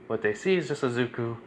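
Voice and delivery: ordinary delivery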